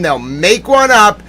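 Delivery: normal talking voice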